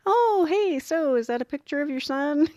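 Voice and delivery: high, bright small talk to dodge the needle; breezy nervous chatter at the nurse; chatty distraction. Retold or imitated. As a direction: chirp up to change the subject. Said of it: "But I look up and like".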